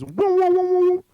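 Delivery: high, loud wavering woo; wobbly ringing glass sound; imitated glass resonance. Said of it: "it goes".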